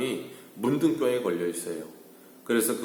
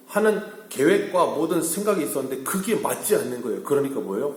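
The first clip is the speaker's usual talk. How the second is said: raised, higher-pitched pulpit voice; emphatic preaching; 5 semitones higher, 4 dB louder than usual. Acted. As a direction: preach it with rising insistence. Preacher drives the point home. (힘주어 높인 설교조)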